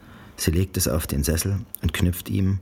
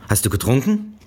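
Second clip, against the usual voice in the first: barsche Mutter; 8 semitones higher, 4 dB louder than usual. A narrator's reading. Mutter verhört Janice.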